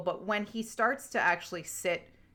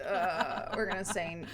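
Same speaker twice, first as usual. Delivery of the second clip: ordinary talk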